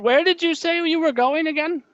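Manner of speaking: very high cartoon gnome. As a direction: squeak it as the gnome. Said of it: "He said".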